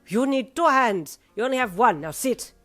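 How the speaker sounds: put-on loud, accented bossy order; blunt scolding. A narrator's reading. Missus Happy ordering one-armed Pa to sit, blunt. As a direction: scold him, then command firmly.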